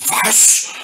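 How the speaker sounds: put-on outraged child's exclamation; indignant cry. A narrator's reading. Hans objecting to the fairy, with an indignant cry. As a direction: blurt it out in disbelief. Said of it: "rief Hans empört".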